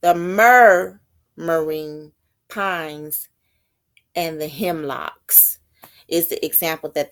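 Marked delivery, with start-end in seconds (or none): 0.0-5.6 slow, drawn-out recitation of "The murmuring pines"; measured recital of a poem line; slow poem reading. Shown as a demonstration. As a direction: read the line like verse, lingering.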